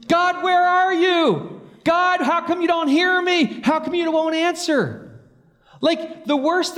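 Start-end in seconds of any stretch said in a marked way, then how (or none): 0.1-5.1 very slow, much higher, a pleading cry; psalm lament. Pretend. Psalmist calling to God, in an acted pleading lament. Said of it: "half the Psalm says that".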